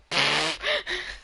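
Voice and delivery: high laugh breaking through; burst of laughter. Real, not acted. Laugh mid-sentence.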